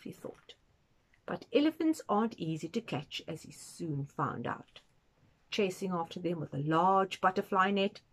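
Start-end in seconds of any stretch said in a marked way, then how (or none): none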